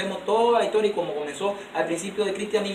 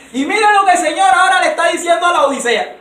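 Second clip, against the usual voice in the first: loud, high scripture proclamation; fiery preaching; 8 semitones higher, 9 dB louder than usual. Acted. A preacher reading the Lord's rebuke to Laodicea, loud and high. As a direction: thunder the verse at the church.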